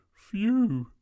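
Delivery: high, loud phew of relief; storybook dog's sigh of relief; relieved dog. A narrator's reading. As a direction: let out a big phew as the dog. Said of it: "said the dog".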